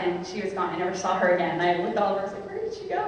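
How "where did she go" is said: puzzled. Retold or imitated. Looking for the vanished lady.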